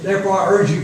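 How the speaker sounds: usual speaking voice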